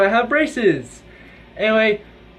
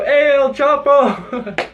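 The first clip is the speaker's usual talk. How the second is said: drawn-out mocking call, higher and louder; 7 semitones higher, 4 dB louder, much slower than usual. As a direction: sing it out slowly like a teasing nickname. Calling out nickname to kid.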